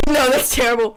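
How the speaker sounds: normal talking voice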